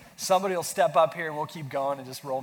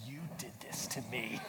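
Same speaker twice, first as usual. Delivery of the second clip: mock accusing groan; 4 semitones lower, 12 dB quieter than usual. Joking blame at sick wife.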